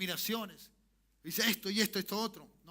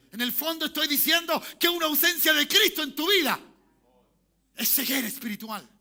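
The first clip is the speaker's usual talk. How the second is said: raised, loud pulpit cry; full-force preaching; 7 semitones higher, 9 dB louder than usual. Real, not acted. Preacher warning congregation of life without Christ, full-force preaching. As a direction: drive the point home at a shout.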